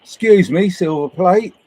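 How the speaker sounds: loud, high, demanding mock tourist; hammed-up indignant request, rising at the end; angry English tourist. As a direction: demand it like a cross Englishman abroad. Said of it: "They're so angry and so controlling".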